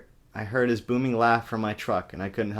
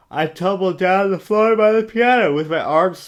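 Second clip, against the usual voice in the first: loud, much higher, overdone; melodramatic read; 9 semitones higher, 8 dB louder than usual. Pretend. Reader overacting the climax, shrill dramatic. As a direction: ham up the fall.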